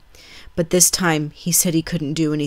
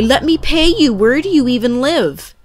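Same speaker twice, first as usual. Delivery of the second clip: much higher and louder, eager gratitude; 9 semitones higher, 7 dB louder than usual. Retold or imitated. Narrator pressing the departing stranger, eager and loud. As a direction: rush out the offer excitedly.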